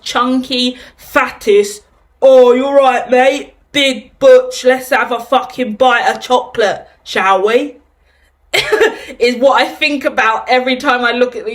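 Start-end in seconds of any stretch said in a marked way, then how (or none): 2.2-7.8 lightly hammed-up Cockney geezer, louder at first; playful Cockney bloke impression. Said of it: "so many Cockney impressions". 8.5-9.1 high-pitched giggle at own impression; real burst of giggling; amused laugh. Real, not acted.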